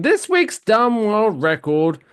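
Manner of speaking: hammed-up game-show host intro; segment announcer. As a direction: announce the segment title with showmanship.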